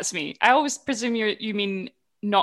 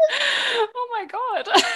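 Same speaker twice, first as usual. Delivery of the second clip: laughing; much slower than usual. Real, not acted. Laughing at fake friends joke.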